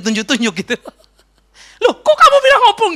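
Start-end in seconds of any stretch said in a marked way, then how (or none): 1.8-2.9 higher and louder, indignant grandma; grandma protesting. Retold or imitated. Grandma protests to granddaughter who blamed her, higher and louder. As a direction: act the grandma caught off guard. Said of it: "kata opung".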